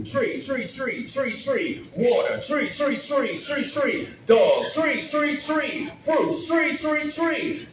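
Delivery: high sing-song listing of trees; tree, tree, tree in a high chanting cadence. Shown as a demonstration. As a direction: chant each tree like a bouncy rhythm. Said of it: "in the same cadence".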